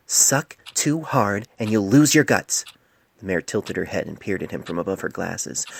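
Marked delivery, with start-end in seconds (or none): 0.1-2.7 slow dry retort. A narrator's reading.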